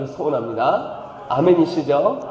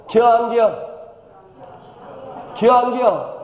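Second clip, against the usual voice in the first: higher, terse Chungcheong dialect question; 4 semitones higher than usual. Shown as a demonstration. Pastor showing how briefly Chungcheong folk speak. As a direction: clip it short the Chungcheong way. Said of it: "충청도는 이렇게 말을 길게 안 해요".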